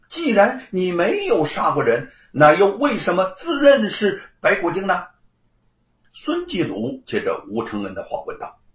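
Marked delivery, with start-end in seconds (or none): 0.1-5.1 louder, higher accusing question; cold challenge. A narrator's reading. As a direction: confront him with understated anger. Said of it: "吴承恩怒视罗万金，冷冷地说". (带着怒意冷冷质问)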